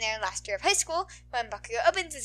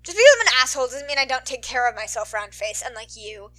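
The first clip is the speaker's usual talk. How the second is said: loud, fast angry growl; a touch of gruff Bakugo, fast and loud; 9 dB louder than usual. Acted. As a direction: snarl it back, defensive and quick. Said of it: "he growls".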